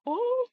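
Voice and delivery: high playful "ooh" squeal; imitated child's squeal. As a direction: copy a toddler's delighted squeal. Imitating Laylee's sound.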